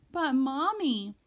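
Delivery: put-on child on the edge of tears; starting to sob. A narrator's reading. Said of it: "starting to get all sobby".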